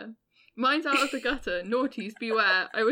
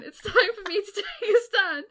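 laughing defiance; 9 semitones higher, 5 dB louder than usual. Real, not acted. Reading the poem's defiant line.

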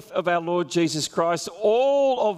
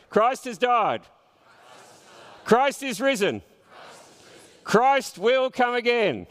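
raised, higher call and response; congregation response; 7 semitones higher than usual. Shown as a demonstration. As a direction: proclaim it for the room to answer. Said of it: "you're gonna respond back".

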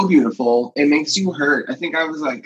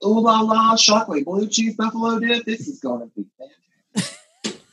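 hammed-up Parisian gush, rising high; mock French flair; 8 semitones higher than usual. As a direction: camp it up like a fake Parisian. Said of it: "when I'm in Paris, I say".

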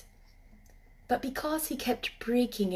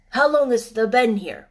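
a hint of a gruff old man, louder; jerked out; 8 dB louder than usual. Ben asking Mary how long she's stayed, abrupt. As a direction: blurt it out abruptly. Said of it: "he jerked out".

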